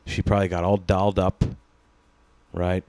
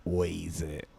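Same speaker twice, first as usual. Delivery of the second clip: softer, hazy half-awake mumble; lightly voiced stoned drawl, much quieter; leaned-out rapper; 2 semitones lower, 10 dB quieter, much slower than usual. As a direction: sound spaced out and barely present. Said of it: "He's just leaned out".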